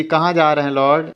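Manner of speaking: plain reading voice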